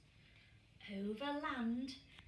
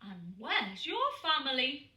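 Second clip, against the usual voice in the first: gentle bear asking; 3 semitones higher, 7 dB louder than usual. A narrator's reading. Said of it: "he said".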